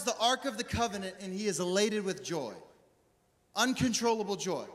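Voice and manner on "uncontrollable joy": ordinary talk, nothing marked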